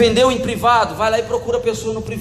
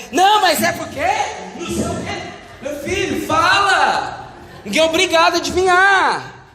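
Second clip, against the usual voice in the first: raised, high exasperated plea; 7 semitones higher than usual. Acted. Preacher scolding people who expect mind-reading, exasperated. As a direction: scold the sulker with mocking impatience. Mocks sulkers, urges them to speak.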